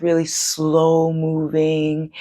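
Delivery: drawn-out, dragging "slow"; sloth-like slow. Shown as a demonstration. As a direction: stretch the words like a sloth. Illustrating a sloth's slowness.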